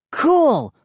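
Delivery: high delighted exclamation; the boy thrilled by the wild serve, high; exclaimed. A narrator's reading. Bright high exclamation. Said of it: "I exclaimed".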